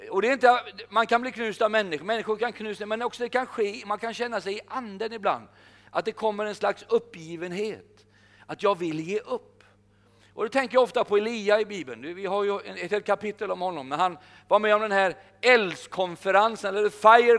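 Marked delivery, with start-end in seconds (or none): none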